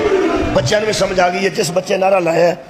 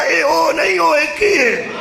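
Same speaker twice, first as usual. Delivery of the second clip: emphatic shout; 9 semitones higher than usual. Real, not acted. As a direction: shout it out with a change of voice. Preacher stresses God is one.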